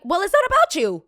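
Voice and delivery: high, loud comeback; exasperated retort. Retold or imitated. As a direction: throw it back at her loud and high. Retold argument with the lady.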